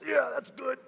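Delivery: high-pitched gassed dentist; high on laughing gas. Retold or imitated. Dentist after a hit of nitrous, high giddy approval. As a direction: pitch it up as if just inhaling.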